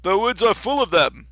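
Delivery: high, loud storybook king. Acted. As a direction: proclaim it as a king.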